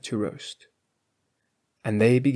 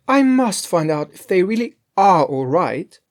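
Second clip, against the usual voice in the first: Grethel's voice; 7 semitones higher, 7 dB louder than usual. A narrator's reading.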